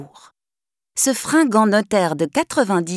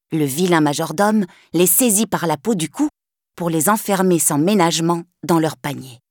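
ordinary delivery

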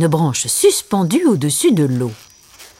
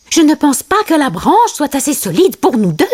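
high-pitched, cautioning character; Timon; 8 semitones higher than usual. A narrator's reading. Timon doubts the branch will hold, high voice. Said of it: "lui conseilla Timon".

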